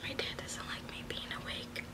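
hushed late-night whisper. Real, not acted. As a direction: whisper so no one wakes. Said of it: "I have to be quiet".

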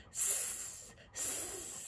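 soft unvoiced hiss; hissing snake. Teacher voicing Snake, quiet hiss. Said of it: "said Snake".

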